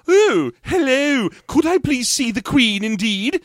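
high, drawn-out parody of clipped London English; mock British accent. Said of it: "that clipped London British accent".

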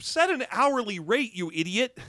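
exasperated. Real, not acted. Exasperated at the subplot's pay rules.

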